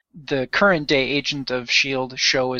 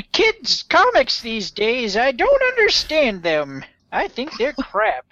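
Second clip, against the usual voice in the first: put-on old man voice; 8 semitones higher than usual. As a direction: grumble like a cranky old man. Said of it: "you should say that in the old man voice".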